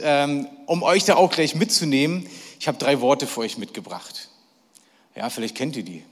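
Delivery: ordinary talk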